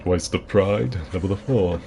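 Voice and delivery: lightly voiced grand villain, kept hushed; a hushed hint of a famous actor's voice; Christopher Lee. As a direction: do a restrained screen-villain impression. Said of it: "You know, my Christopher Lee".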